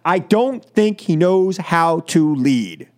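ordinary delivery

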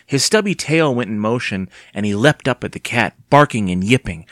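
plain reading voice

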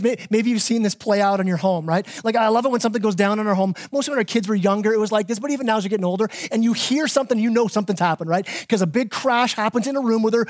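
ordinary delivery

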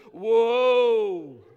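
long loud whoa of wonder; awestruck whoa. Retold or imitated. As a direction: let it out as if seeing her for the first time. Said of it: "that's the kind of whoa".

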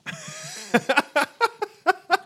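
squealing high laugh. Real, not acted. Big laugh after Doppler ganger.

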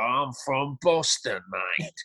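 put-on slow, mangled Boston accent with an Essex edge; mock a Londoner faking Boston; exaggerated bad Boston accent, drawn out. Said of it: "he does this crazy Boston accent".

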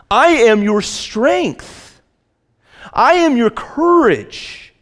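high, loud, slow proclamation, every "am" hammered; full-force preaching. Acted. Preaching God's promises at full force.

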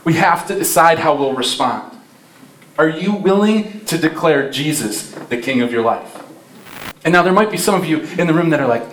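ordinary talk, nothing marked

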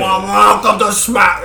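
put-on a tired old man fumbling his line; bad acting. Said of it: "couldn't deliver it like he used to".

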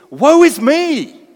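slow, loud, high lament of woe; a prophet overwhelmed, crying woe, slow and loud; Isaiah undone. Acted. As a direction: cry out woe as a man undone. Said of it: "He was completely undone".

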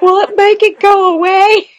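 tearful pleading. Retold or imitated. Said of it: "I'm like".

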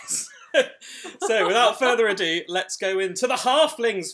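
loud, slow hype build; excited. Real, not acted. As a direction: announce it with big energy. Host hypes the segment.

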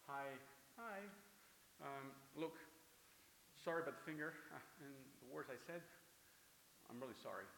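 halting, awkward and soft; sheepish apology. Acted. Believer apologizing to the person they cursed, sheepishly. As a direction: hesitate between phrases, embarrassed.

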